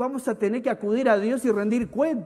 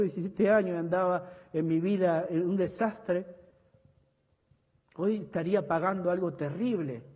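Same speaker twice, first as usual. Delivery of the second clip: ordinary delivery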